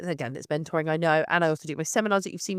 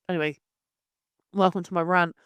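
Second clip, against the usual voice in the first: ordinary talk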